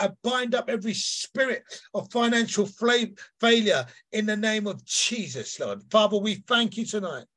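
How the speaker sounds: forceful, driving prayer leader's voice; fervent prayer. Real, not acted. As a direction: pray with rising emphasis on key words.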